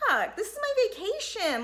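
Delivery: a friend fed up on holiday; annoyed friend. Retold or imitated. Imagined friend complaining about the vacation, exasperated. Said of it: "I'd be like".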